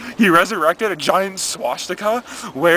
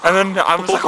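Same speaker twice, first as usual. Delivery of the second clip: ordinary delivery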